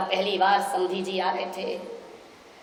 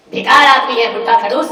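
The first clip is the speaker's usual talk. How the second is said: contemptuous rant; 8 dB louder than usual. Retold or imitated. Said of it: "ससुर".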